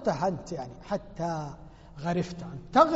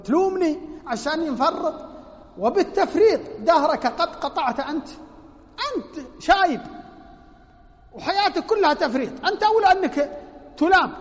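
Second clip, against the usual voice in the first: put-on rising, louder rebuke of an old father; reproachful son; 6 semitones higher, 6 dB louder than usual. Son scolding his aging father, louder and higher.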